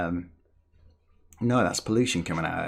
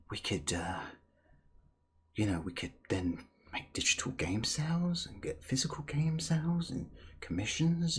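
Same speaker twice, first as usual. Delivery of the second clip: mock whisper; 2 semitones higher, 7 dB quieter than usual. Mocking Microsoft's scheming.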